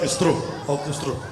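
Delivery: low, soft dreamy agreement; a hint of swooning women. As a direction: sigh it like an admirer.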